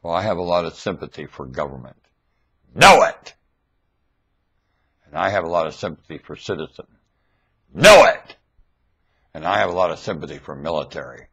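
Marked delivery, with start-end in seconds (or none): 2.7-3.4 loud, high rising shout; shouted refrain. Real, not acted. 7.7-8.4 loud, high rising shout; shouted refrain. Real, not acted.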